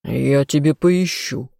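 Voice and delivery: slow, possessive growl; growled threat. A narrator's reading. Jealous lover warns the heroine off others, growling slowly. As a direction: growl it out slowly as a playful warning. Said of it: "рыкнул любимый".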